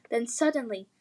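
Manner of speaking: ordinary delivery, nothing marked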